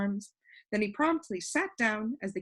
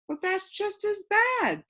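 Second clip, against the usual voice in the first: protesting; 12 semitones higher than usual. A narrator's reading. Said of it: "protested Milo".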